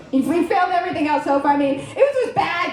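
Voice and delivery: ordinary talk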